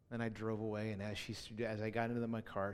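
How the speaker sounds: normal talking voice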